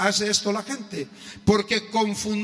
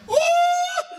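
high boastful whoop; 5 dB louder than usual. Retold or imitated. Young man boasts he knows him.

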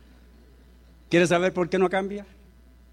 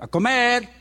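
loud, high call to dinner; wife calling the family from the kitchen; 7 semitones higher, 6 dB louder than usual. Retold or imitated. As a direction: shout across the house to come eat. Wife calls again.

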